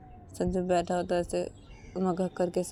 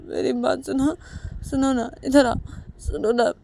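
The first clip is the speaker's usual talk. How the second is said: broken sobbing pleas; crying plea; 7 semitones higher than usual. Acted. Sanju, crying, begs Uma to listen. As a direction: beg her to listen through tears. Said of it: "ऐसे रोते रोते संजू".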